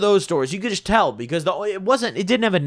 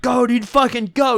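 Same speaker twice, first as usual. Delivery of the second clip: higher, urgent mock shout to drive; urgent yell; 7 semitones higher, 3 dB louder than usual. Friend urging the driver to flee, high urgent yell. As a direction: imitate the panicked friend yelling go. Fleeing the shop owner in car.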